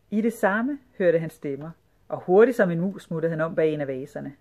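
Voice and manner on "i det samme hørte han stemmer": plain reading voice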